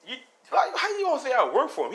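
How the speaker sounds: incredulous laughing rant; laughing disbelief. Retold or imitated. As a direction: scoff it off in disbelief. Deku incredulous at accusation.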